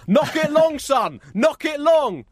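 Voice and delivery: high, loud cheering at a son; touchline shouting. Retold or imitated. Parent on the touchline yelling to his son, loud. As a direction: bellow encouragement across the pitch. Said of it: "You're probably gonna hear them say".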